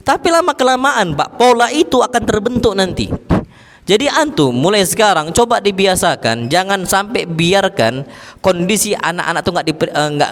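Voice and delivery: normal talking voice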